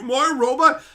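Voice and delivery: high, loud alarmed question; imitating someone freaked out by self-doubt; mock existential panic. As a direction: blurt it out as if head-fucked. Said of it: "he gets so head fucked that he's like".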